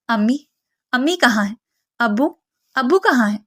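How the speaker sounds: ordinary delivery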